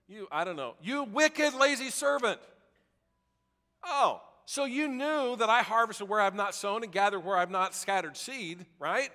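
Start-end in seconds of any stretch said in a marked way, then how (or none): 0.8-6.2 louder and higher, scolding the lazy servant; angry master's rebuke. Acted. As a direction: bark it out like a furious master.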